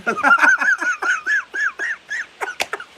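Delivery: long squealing high laugh; high giggling fit. Real, not acted. Streamer to chat, cracking up at the raid, squealing laugh. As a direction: burst into a helpless high-pitched giggle.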